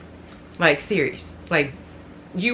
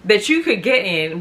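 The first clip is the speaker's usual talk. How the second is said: ordinary talk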